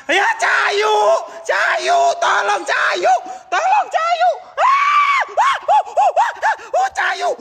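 high, terrified shrieks calling Cayu; panicked screaming for help. Real, not acted. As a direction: scream for your friend in real terror. (jeritan panik minta tolong)

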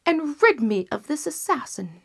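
high, loud royal command; furious king. Acted. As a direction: order the kill with rising rage. King orders executioner.

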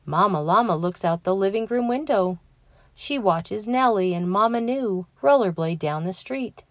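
plain reading voice

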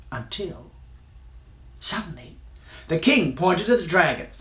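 ordinary delivery

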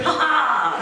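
a cheeky triumphant laugh, hammed up; gleeful cackle. Actor running off stripping his costume, gleeful cackle. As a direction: laugh like a naughty schoolboy escaping. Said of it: "And he's going".

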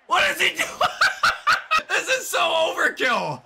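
high-pitched shout breaking into laughter; laughing in squeaky disbelief; incredulous laughing. Real, not acted. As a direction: crack up mid-question. Cracking up at the overkill.

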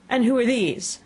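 high, demanding queen; imperious queen. A narrator's reading. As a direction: question them like a stern monarch. Queen demands about the gardeners.

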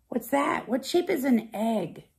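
plain reading voice

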